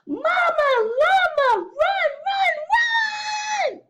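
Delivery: high, loud mock scream. A narrator's reading. Baby llama begs his mama to come, screaming. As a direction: scream it as a desperate plea. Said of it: "So he's screaming for his mama".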